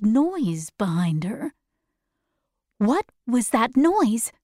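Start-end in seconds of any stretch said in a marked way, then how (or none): none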